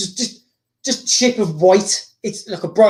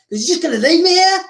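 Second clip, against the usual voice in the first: 气呼呼地高声质问; 句尾上扬，难以置信地恼火反问; Riddick; 7 semitones higher than usual. Acted. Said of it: "it's all pissed off".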